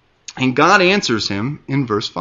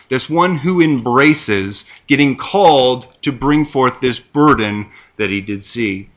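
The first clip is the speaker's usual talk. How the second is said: ordinary delivery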